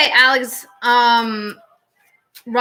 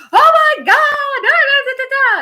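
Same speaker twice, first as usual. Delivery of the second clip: excited shriek; 6 dB louder than usual. Retold or imitated. Friend finding his pet snake in the closet, squealing with joy. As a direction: shriek it like a friend who just found his lost pet. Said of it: "It was like".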